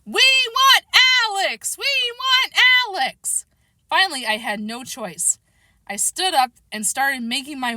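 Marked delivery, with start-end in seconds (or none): 0.1-3.4 high-pitched crowd chant, drawn out; kids shouting. A narrator's reading. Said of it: "started shouting".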